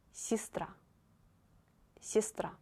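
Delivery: Сестра said "si-STRA". Shown as a demonstration. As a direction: model Сестра with "ee" in the first syllable. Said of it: "you pronounce it like I".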